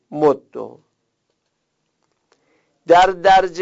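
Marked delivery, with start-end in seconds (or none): 0.1-0.6 مد with doubled d ending in "u"; damma ending. Shown as a demonstration. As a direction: say مد as mod-du. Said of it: "حرکت ضمه نیز". (مد با دال مشدد و ضمه)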